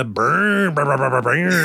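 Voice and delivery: vroom noises like a revving car; revving engine impression; imitated car engine. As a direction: mouth the roar of cars tearing past.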